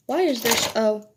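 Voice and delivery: normal talking voice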